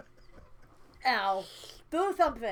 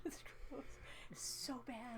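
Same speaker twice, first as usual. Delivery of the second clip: hushed whisper; 2 semitones higher, 16 dB quieter than usual. Real, not acted. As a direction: whisper it in disgust. Tongue in trap whisper.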